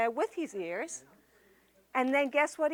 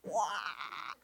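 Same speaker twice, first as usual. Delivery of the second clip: voiceless wheeze; 5 semitones lower, 10 dB quieter than usual. Acted. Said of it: "all that came out was".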